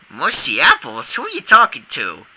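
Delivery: ordinary delivery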